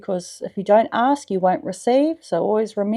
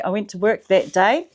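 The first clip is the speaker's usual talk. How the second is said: ordinary talk, nothing marked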